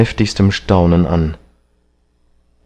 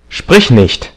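sharp, higher command; furious; 6 semitones higher, 4 dB louder than usual. A narrator's reading. Enraged young man silencing the painter, furious. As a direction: cut the other off angrily. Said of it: "tatsächlich blass vor Wut".

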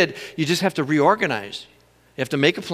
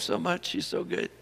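whisper; 13 dB quieter than usual. Real, not acted. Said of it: "tender aside about wife".

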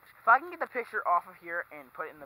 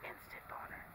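whispering; 19 dB quieter than usual. Real, not acted. Whispered joke aside.

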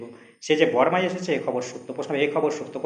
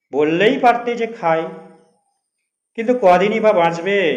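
put-on elderly advice; 6 semitones higher, 8 dB louder than usual. Old officer scolding, gently advising.

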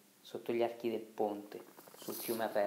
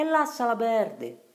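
very high, loud, slowed shout; shouting across water; 13 semitones higher, 12 dB louder, slower than usual. Acted. Boat companions warning Riccetto from afar, loud shout. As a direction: yell the warning far across the current. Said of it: "gridarono i compagni della barca".

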